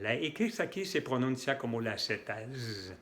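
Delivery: usual speaking voice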